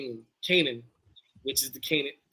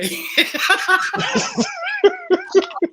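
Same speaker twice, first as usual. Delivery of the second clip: long high laugh; high wheezing laugh; 6 dB louder than usual. Real, not acted. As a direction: laugh until breathless. Laughing after rant.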